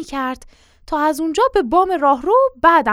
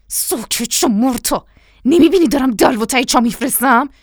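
out of the mouth's corner; 2 semitones higher, 5 dB louder than usual. A narrator's reading. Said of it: "از گوشهٔ دهنش گفت".

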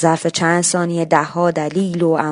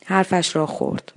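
usual speaking voice

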